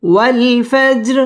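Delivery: drawn-out melodic Quran recitation; slow recitation. Shown as a demonstration. Teacher reciting slowly to the class.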